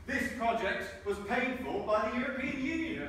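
high, slow, sarcastic reading of the sign; drawn-out mockery of the official wording. Speaker mocking the EU sign wording with slow sarcasm.

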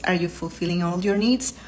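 normal talking voice

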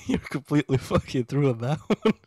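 words pushed out through laughter; laughing loud and high. Real, not acted. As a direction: talk while cracking up. Laughing at partner's joke.

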